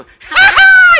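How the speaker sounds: high, loud joyful laugh; loud burst of laughter. Real, not acted. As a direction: laugh out big. Laughs at good news.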